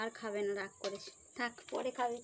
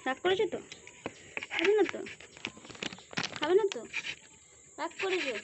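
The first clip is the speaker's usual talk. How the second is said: put-on cooing baby talk; 6 semitones higher than usual. Coaxing sulking pet, baby talk.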